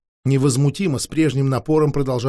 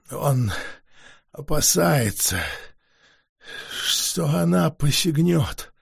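exhausted vampire forcing out words; weary vampire voice; 3 semitones lower, 4 dB quieter than usual. Acted. Vampire explains slowly.

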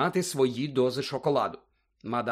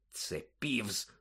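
muttered, much softer; low grumble; 5 semitones lower, 10 dB quieter than usual. A narrator's reading. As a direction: grumble it under your breath. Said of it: "пробурчав Гаррі". (тихе бурчання)

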